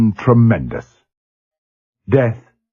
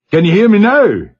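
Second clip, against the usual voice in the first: loud, much higher booming call; booming over speaker; 11 semitones higher, 5 dB louder than usual. A narrator's reading. Gunner on the intercom booming up to the bridge. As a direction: boom it through a crackling loudspeaker. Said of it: "the speaker boomed".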